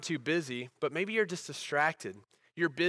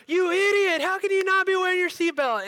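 much higher, louder mock yell at the husband; angry wife yelling; 7 dB louder than usual. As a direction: pregnant wife scolding, hammed up and shrill. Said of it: "She's like".

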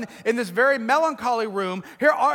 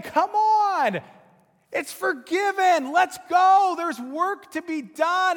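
urging, rallying; 7 semitones higher, slower than usual. Retold or imitated. Jesus calling us by name.

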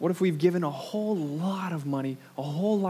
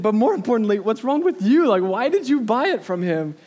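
higher, louder exasperated wife; wife Leah scolding husband, higher and louder; 7 semitones higher, 8 dB louder than usual. Retold or imitated. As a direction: voice Leah's scolding. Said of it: "And then she said".

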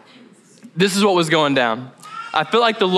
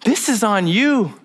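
higher firm voice of God; higher, firm rebuke to Josh; God's rebuke; 7 semitones higher than usual. Retold or imitated. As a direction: set him straight, firmly. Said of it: "He was like".

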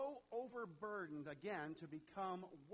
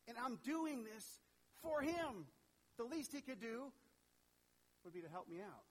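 higher, louder aggrieved grumbling; resentful inner voice; 5 semitones higher, 3 dB louder than usual. Acted. Congregation's inner grumble against God, higher aggrieved indignation. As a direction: vent the unfairness, building indignation. Voicing our own resentful thoughts.

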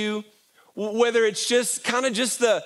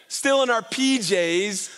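normal talking voice

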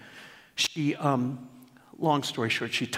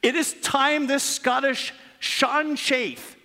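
raised, slower storybook reading voice; reading aloud; 10 semitones higher than usual. Acted. Pastor reading his daughter's tribute to the church, raised storytelling read. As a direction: read it like a storyteller opening a tale.

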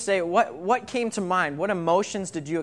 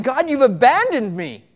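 anguished cry; 7 semitones higher, 9 dB louder than usual. Acted. Voicing David's despair.

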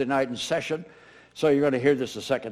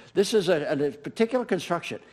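ordinary talk, nothing marked

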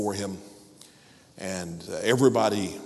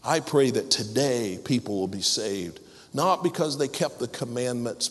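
rising, fervent prayer voice; closing prayer; 5 semitones higher than usual. Acted. Pastor praying for the congregation, fervently. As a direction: pray over the congregation with mounting fervor.

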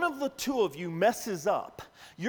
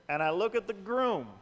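ordinary talk

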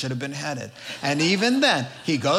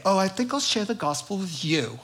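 put-on British accent, pitched higher; 4 semitones higher than usual. Preacher picking whom to share the gospel with, mock British accent. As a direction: slip playfully into a lilting British voice. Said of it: "I don't know why I became British there".

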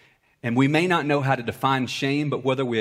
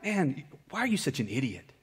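a hint of a sneering inner voice, softer; inner voice of shame; 7 dB quieter than usual. Preacher voicing shame's put-down to the church, a mild sneer. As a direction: voice the nagging shame inside you, lightly. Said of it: "Shame is that voice that says".

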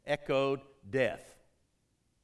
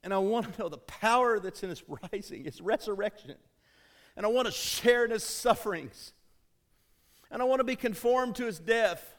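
full-force preaching; 6 semitones higher than usual. Acted. Pastor urging the congregation toward Christ, fervent preaching. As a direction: preach it out to the church with mounting fire.

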